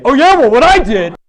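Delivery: loud, high, jumping-in voice; mock eager one-upper; eager interrupter. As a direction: cut in to top their story. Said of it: "then it's like".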